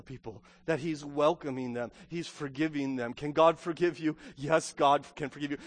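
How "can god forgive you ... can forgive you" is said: ordinary delivery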